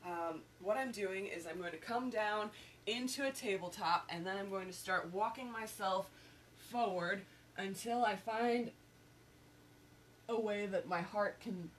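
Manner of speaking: ordinary talk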